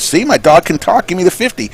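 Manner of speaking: a touch of a smug dog owner; dog owner boasting. Acted. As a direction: gloat to the bartender. Dog owner quoted in joke.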